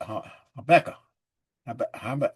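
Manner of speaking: normal talking voice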